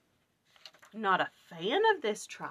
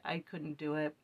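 usual speaking voice

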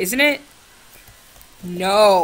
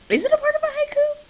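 very high fast squeaky voice; fast cartoon squeak; weird voice; faster than usual. Shown as a demonstration. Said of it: "I said that so weird".

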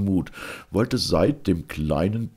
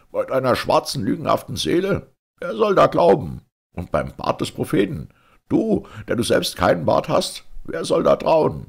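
higher-pitched, scornful outcry; merchant's shout; 8 semitones higher, 3 dB louder than usual. A narrator's reading. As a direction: fire off indignant questions, punching them upward. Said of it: "schrie der Kaufmann".

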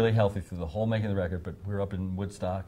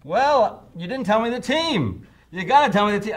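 demanding announcer; 11 semitones higher, 8 dB louder than usual. Retold or imitated. Phil demanding the team name.

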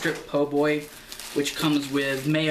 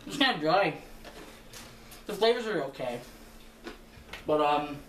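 chewing while talking; words muffled by food; mouth full of food; 4 dB quieter than usual. Real, not acted. Seen 1: Took a bite at -5; cheeks stay bulging and lips pursed throughout.

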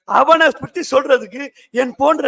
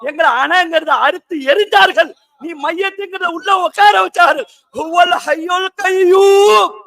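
raised sermon voice building to a long-held final cry; fervent preaching; 5 semitones higher, 3 dB louder than usual. Real, not acted. Preacher proclaiming the Ever-Living to the congregation, fervently.

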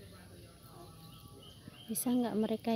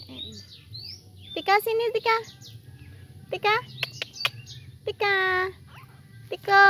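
higher, louder calling Tika; 9 semitones higher, 4 dB louder than usual. Real, not acted. Calling her squirrel Tika to come over, higher louder call. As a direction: call the pet over from across the room.

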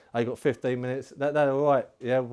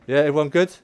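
loud, high, fired-up team rally; roaring pre-match rally at the team; rallying shout; 9 semitones higher, 12 dB louder than usual. Real, not acted. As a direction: gee the lads up, half laughing. Pumping team up before kickoff.

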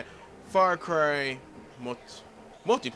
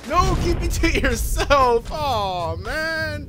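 high loud sarcastic no; mock indignant; 12 semitones higher, 8 dB louder, much slower than usual. Real, not acted. Gamer mock-scolding teammates, loud indignant. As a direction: dramatize the betrayal, higher and louder. Teammate won't revive him.

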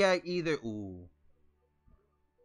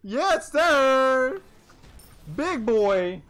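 hyped cheer; 7 semitones higher, 9 dB louder, much slower than usual. Real, not acted. Celebrating the kill.

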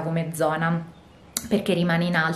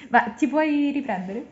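laughing; 9 semitones higher than usual. Real, not acted. Laughing at her friend filming.

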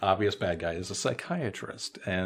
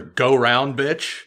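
louder mock shout at a driver; retold yell; 5 semitones higher, 10 dB louder, much slower than usual. Host retelling a character yelling at a driver, louder. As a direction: bark it at the honking lady. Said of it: "she yells at a lady".

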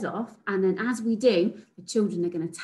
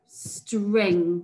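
slow and drawn out. Shown as a demonstration. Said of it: "teacher models word".

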